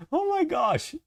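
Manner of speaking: high, exasperated squeal. Real, not acted. Streamer still hunting for the emote, exasperated. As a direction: let it out as a high little wail.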